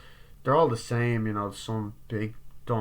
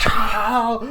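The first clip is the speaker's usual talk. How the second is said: sudden loud high yell; Ghostface attack yell; 12 semitones higher, 11 dB louder than usual. Retold or imitated. Ghostface leaping out at Sydney, loud yell. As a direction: burst out with a jump-scare shout.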